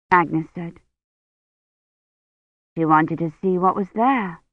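ordinary delivery

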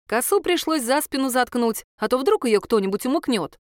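ordinary delivery, nothing marked

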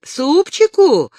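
a high, loud and drawn-out indignant echo; angry, half-voiced. A narrator's reading. The ataman grumbles at Dasha's wish for soup, indignant. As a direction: scoff at the very idea of soup. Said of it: "рассердился Заматоха, но вполголоса".

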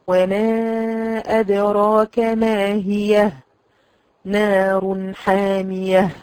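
very slow, drawn-out ما هي with clear ha; slow recitation example. Shown as a demonstration. Teacher recites the verse slowly for her students. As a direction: intone ما هي slowly. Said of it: "الهاء خلي بالك".

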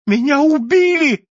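high, loud cry of alarm; the invisible man crying out to the boss; desperate shout. A narrator's reading. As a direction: yell it in panic. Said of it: "закричал невидимый".

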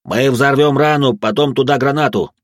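loud, firm battle orders; shouting commands. Acted. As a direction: command the team over the noise. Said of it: "начал раздавать команды я".

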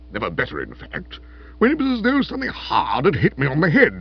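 put-on voice of an ailing lord; weak, brave invalid lord. A narrator's reading. Invalid lord telling the aunt of his fall, weakly brave.

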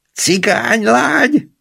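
very high, slow whooping yell; drawn-out yell. A narrator's reading. Narrator whoops at his friend before they both laugh, high yell. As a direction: bellow it high, stretching the word out. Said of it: "ordítottam".

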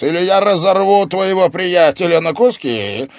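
high, very loud villain's threat; enraged sorcerer shouting; roared threat. A narrator's reading. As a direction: threaten the captive at full force. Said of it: "потребовал голос".

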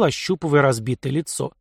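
ordinary delivery, nothing marked

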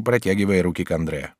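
usual speaking voice

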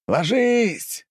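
sharp high yell of warning. Acted. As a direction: shout it down at them. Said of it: "заорал он по-английски".

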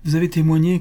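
voix plus aiguë de belle-mère mielleuse; belle-mère insistante. A narrator's reading. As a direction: une belle-mère qui plaide, légèrement plus haut. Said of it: "continua-t-elle". (belle-mère qui cherche à convaincre son gendre, voix légèrement plus aiguë)

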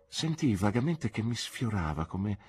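ordinary talk, nothing marked

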